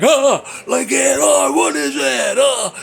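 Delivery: high, loud startled cry; mock startled shout. Viewer startled by the monster reveal, mock shout. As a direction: act out the jolt of a jump scare.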